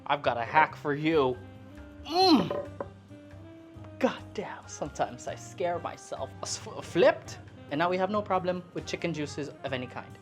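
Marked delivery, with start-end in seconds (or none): none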